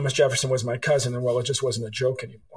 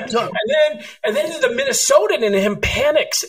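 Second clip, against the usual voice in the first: laughing while telling; 12 semitones higher, 4 dB louder than usual. Real, not acted. Laughing at own punchline.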